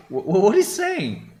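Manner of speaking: stammering, high-pitched bafflement; baffled. Real, not acted. Streamer lost at a dialect phrase, totally baffled. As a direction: stumble into a high, incredulous question.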